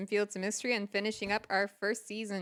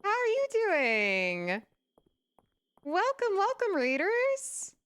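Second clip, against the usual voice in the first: cute anime streamer; 7 semitones higher, much slower than usual. Real, not acted. Streamer greeting raiders excitedly.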